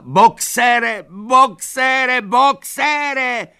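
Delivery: very high, loud, frantic calls; old mare screaming after her friend; desperate shrieking. A narrator's reading. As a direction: scream his name after the cart. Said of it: "křičela".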